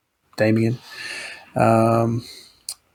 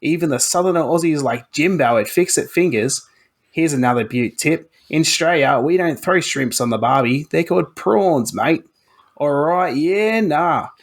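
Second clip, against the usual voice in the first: put-on broad Aussie bogan accent; 5 semitones higher than usual. Reading the review aloud to the hosts in a hammed-up Aussie bogan accent. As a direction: lay the Aussie bogan accent on thick, mate. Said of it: "you want me to put on an accent".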